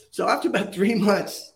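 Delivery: ordinary talk, nothing marked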